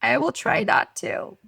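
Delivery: high, slow, careful reply; mock-sheepish squeezed voice; strained promise. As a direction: promise it with effort. Retold strained promise to son.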